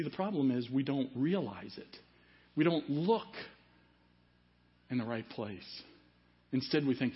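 normal talking voice

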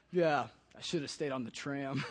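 defeated. Retold or imitated. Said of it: "all defeated".

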